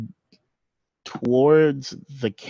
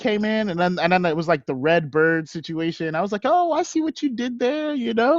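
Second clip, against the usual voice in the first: high, overselling dude voice; squeaky nervous bro; high flustered bro; 10 semitones higher, faster than usual. Acted. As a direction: fake it, pitched up high.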